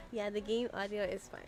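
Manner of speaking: normal talking voice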